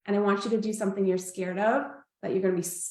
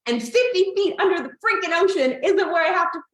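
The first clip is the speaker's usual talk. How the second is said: high, loud and indignant; freaked-out objection, acted back; retold panicked protest; 8 semitones higher, 5 dB louder than usual. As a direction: protest in rising alarm, louder and higher. Retold panicked protest before the dive.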